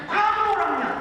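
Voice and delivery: high, loud re-enacted cry; imitated shout. Grandpa declares it to the startled preacher, imitated high shout. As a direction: belt it out as the overwrought grandpa. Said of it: "Dia teriak dengan mata berkaca-kaca".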